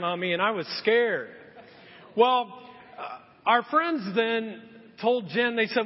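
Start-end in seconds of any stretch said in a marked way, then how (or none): none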